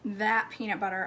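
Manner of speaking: ordinary talk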